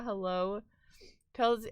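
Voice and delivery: usual speaking voice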